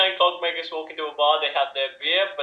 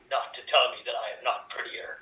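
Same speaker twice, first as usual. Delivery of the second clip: whispering; 5 semitones lower, 7 dB quieter than usual. Acted. Whispered question in scene.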